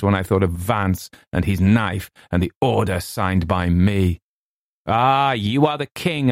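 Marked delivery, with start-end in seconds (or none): 4.9-6.3 high, declamatory cry; mildly raised, dramatic outburst; impassioned cry. A narrator's reading. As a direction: cry it out grandly, pitched up. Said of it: "I cried".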